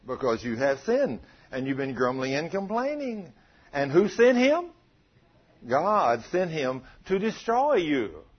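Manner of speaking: ordinary talk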